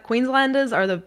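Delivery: ordinary talk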